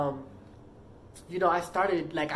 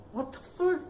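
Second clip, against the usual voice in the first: retold outrage; 8 semitones higher than usual. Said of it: "Like, I was pissed".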